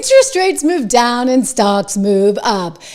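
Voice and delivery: slow, loud, gloating repeat of the anchor; a hammed-up gloating echo; mocking echo. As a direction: savour every word like a victory lap.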